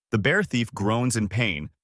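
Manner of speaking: plain reading voice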